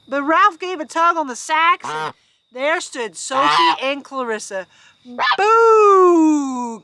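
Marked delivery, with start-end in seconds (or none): 5.4-6.8 long, louder cow-like boo, pitched up; mooing boo. Acted. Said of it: "Clarissa mooed".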